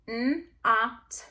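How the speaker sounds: N-ot split in two; sounding out short o. Shown as a demonstration. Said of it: "closed syllable".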